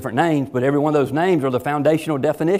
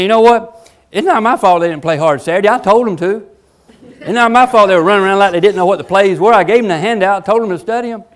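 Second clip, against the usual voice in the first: higher, louder mock coach blaming his players; excuse-making coach; 5 semitones higher, 4 dB louder than usual. Coach on Monday morning blaming players, hammed-up indignant. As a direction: sell the excuse with full bluster. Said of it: "a coach getting up on Monday morning saying".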